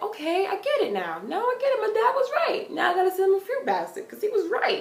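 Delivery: mock-giddy realisation; excited realisation. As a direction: squeal it like it finally clicked. Sudden realisation, retold.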